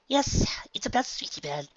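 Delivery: Rarity voice. A narrator's reading. Rarity admits the reason.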